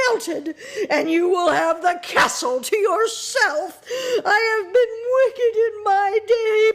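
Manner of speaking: high, loud, crying, half-cackling witch; dying witch lamenting to Dorothy, crying; wailing witch. A narrator's reading. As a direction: wail through sobs as you melt away.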